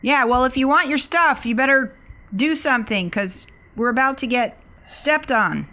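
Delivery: ordinary delivery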